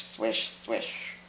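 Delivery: breathy whispered swish, swish. Acted. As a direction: whisper the swishes like hips swaying. Narrating hips swinging.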